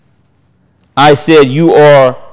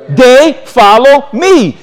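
they follow me, slow and higher; slow emphasis; 7 semitones higher, 3 dB louder, much slower than usual. Real, not acted. Preacher to congregation, repeating the verse slowly for weight. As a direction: linger on every word.

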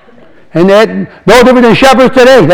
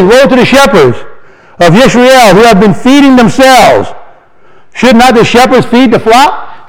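slow, solemn reading of scripture; 4 semitones higher, slower than usual. Acted. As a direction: read the verse like a proclamation. Reads scripture aloud.

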